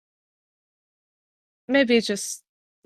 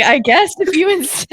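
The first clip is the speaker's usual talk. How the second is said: laughing; 10 semitones higher than usual. Acted. Laughing in character.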